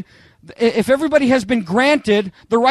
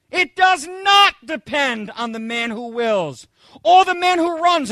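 slow scripture emphasis; 4 semitones higher, slower than usual. Acted.

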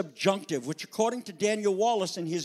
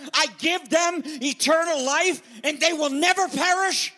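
louder, higher, building sermon delivery; fervent preaching; 9 semitones higher, 5 dB louder than usual. Acted. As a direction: preach it out with rising fervor. Preaching at full force.

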